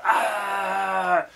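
long, high "Ah!" imitating frantic energy; mock frenzied yell. Coach showing rappers what not to do, mock frenzied yell. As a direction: yell like an overexcited performer, in mockery. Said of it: "You don't have to be like".

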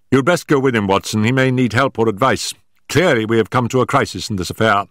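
lightly voiced Holmes, decisive. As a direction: Holmes giving Watson orders.

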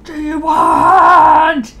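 loud, very slow, crying moan; angry wail as the Sim. Pretend. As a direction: drag it out like a furious woken wife. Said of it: "So mad at him".

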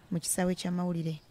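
ordinary talk, nothing marked

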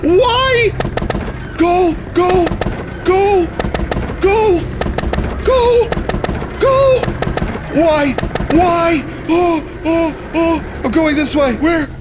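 flee in terror, squealing; panicked screaming. Real, not acted. Fleeing a chaser in the game.

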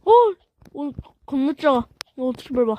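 ordinary talk

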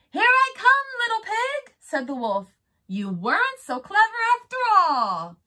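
loud, high gleeful taunt; gloating shout. Acted. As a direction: crow it out, sure of winning. Wolf gloats sliding down.

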